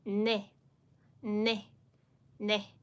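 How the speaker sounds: ne with a nasal n; 把“Ne”发成带鼻音的n. Shown as a demonstration. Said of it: "the nasal sound".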